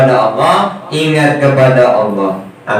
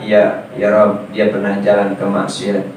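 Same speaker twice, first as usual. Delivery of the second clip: humble witness answer; 5 semitones lower, 4 dB quieter than usual. Acted. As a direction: lower and softer, a body part bowing before God. Feet answering God's question. (suara saksi yang rendah dan pelan)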